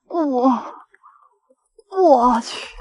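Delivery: ordinary talk, nothing marked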